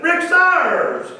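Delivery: loud, high call over the intercom; intercom page. Retold or imitated. Salesman paging Rick over the intercom, loud triumphant call. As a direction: page him like a PA announcer. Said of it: "I go to the intercom and I said".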